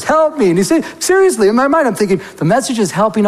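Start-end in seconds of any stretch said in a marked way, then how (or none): 0.0-0.5 mock yelling. Preacher recalls the man's cry, mild mock yell. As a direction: echo a man's cry for help. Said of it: "he kept yelling".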